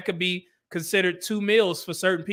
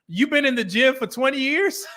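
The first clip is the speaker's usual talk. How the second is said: put-on higher-pitched incredulous kid's question; imitated disbelief; 7 semitones higher than usual. Little boy doubting twenty years of gym, imitated high disbelief. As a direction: echo it back in mock disbelief, rising at the end.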